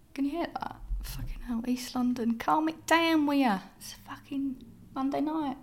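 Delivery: badly faked East London Cockney moan; awful Cockney accent. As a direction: grumble at the neighbours in bad Cockney. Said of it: "my awful Cockney accent".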